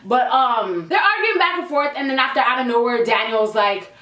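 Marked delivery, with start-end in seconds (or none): none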